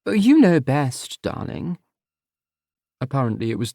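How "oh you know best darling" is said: higher, slow patronising coo fading to a breath; mother dismissing her son with fake deference; sweetly condescending mother. Mother patronising her son, higher then breathy.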